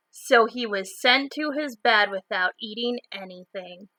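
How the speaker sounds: ordinary delivery, nothing marked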